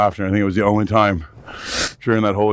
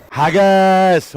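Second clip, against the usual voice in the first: mock Scottish shout; 9 semitones higher, 5 dB louder than usual. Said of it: "I think that's Scottish".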